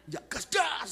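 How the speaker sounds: high, loud burst reenacting the chase; acted excitement. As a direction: floor it with your voice. Reenacts flooring the gas pedal. (seruan heboh tancap gas)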